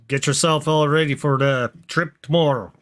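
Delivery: ordinary talk, nothing marked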